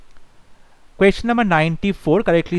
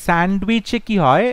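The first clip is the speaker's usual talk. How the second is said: ordinary talk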